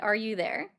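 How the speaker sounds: normal talking voice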